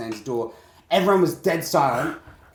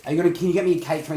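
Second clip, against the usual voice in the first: put-on pestering kid; 4 semitones higher, 4 dB louder, much faster than usual. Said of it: "I don't wanna concentrate".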